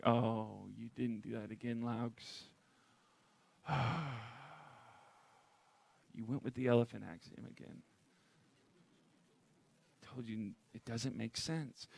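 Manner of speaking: quiet, lightly voiced weary God figure, sighing; a softly let-down deity scolding mildly; disappointed God. God looking down at Laux, mildly let-down scolding.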